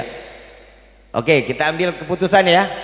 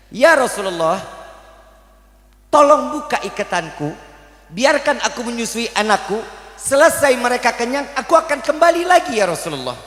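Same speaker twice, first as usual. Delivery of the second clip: higher, pleading mother deer; 7 semitones higher than usual. Acted. Mother deer begging the Prophet, lightly voiced plea. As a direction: beg the Prophet to let you feed your young.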